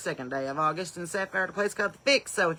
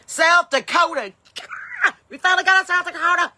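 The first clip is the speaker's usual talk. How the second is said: excited; 10 semitones higher, 9 dB louder than usual. Real, not acted. First South Dakota viewer arrives.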